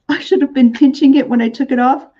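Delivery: laughing. Real, not acted. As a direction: laugh at your own slip.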